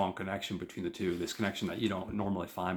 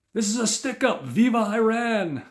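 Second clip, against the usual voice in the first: hold-up shout; 10 dB louder than usual. Retold or imitated. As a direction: burst in and yell it like an armed robber. Robber storms in with a gun.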